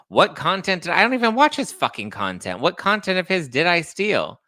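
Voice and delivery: loud, high, indignant demand; indignant outburst. Real, not acted. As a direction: fire back at the accusation, loud and high. Outraged at being accused of stealing.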